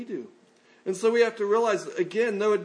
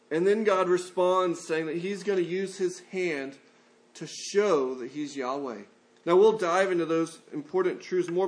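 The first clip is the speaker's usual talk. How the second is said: ordinary delivery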